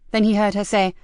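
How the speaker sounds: plain reading voice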